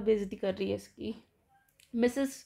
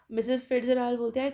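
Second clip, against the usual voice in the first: ordinary delivery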